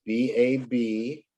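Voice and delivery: usual speaking voice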